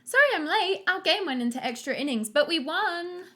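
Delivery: put-on high, bright-eyed sporty girl gushing; bubbly Julie. Late date arriving from her ballgame, high perky gush. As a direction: burst in all perky and breathless with news.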